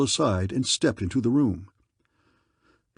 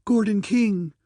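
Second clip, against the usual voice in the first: much higher, a startled call of his name; surprised girl crying out; astonished cry; 10 semitones higher, 3 dB louder, slower than usual. Acted. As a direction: gasp out his name in disbelief. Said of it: "cried the girl".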